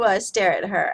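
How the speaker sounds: normal talking voice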